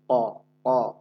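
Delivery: ক said thick with a hard throat push; qaf with a push. Shown as a demonstration. Teacher shows the push of qaf. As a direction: punch ক from the back of the tongue. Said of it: "কফের একটা ধাক্কা আছে".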